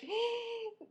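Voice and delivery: high, soft mock gasp; shocked gasp. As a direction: suck in air in mock shock. Acts out her shocked reaction.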